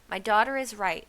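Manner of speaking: loud, forceful backing; shouting. A narrator's reading. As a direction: shout it for the crowd to hear. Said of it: "shouted Trog".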